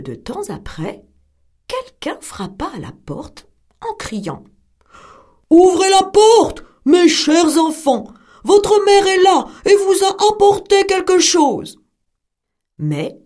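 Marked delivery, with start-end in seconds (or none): 5.5-11.8 loud, higher wolf pretending to be mother; wolf calling through the door, loudly; wolf shouting at the door, rough and raised; wolf's rough voice. A narrator's reading. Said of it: "reconnurent le loup à sa voix rude".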